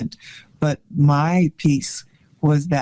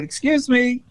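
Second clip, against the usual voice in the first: raised voice, polite but firm; higher, firm excuse me; bold interruption; 8 semitones higher than usual. Retold or imitated. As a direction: cut in on a room of men. Retold interrupting the producers.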